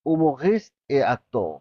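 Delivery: very slow, careful French for learners; slow demo. Shown as a demonstration. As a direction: stretch every word for the class. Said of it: "teacher models French line".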